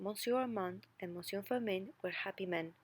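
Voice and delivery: ordinary delivery, nothing marked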